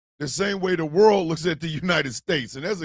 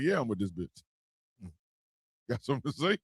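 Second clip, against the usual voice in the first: much lower, much softer, smug and unbothered; smug dog voice; 6 semitones lower, 10 dB quieter, faster than usual. Retold or imitated. Dog staring at the camera, lower and softer. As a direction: give the dog a low, sly, quiet voice.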